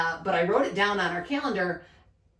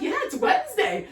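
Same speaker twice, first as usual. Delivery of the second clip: mock cheerful; 4 dB louder than usual. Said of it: "I'm like".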